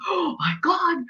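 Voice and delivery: hushed, high gasp of dismay; mock horrified "oh my God"; shocked client. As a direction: imitate a stunned client, soft and high-pitched. Client's shocked reaction retold.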